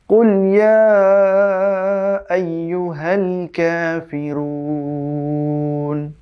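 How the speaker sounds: slow melodic tajweed chant, vowels stretched long; Quran recitation. Shown as a demonstration. Teacher recites the verse again for the class, slow chant. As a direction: chant the verse slowly and carefully. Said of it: "আবারও বলছি".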